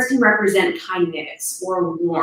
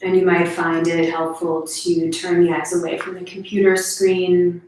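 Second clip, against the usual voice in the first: guided meditation; 2 semitones lower than usual. Real, not acted. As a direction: gently guide listeners through their senses.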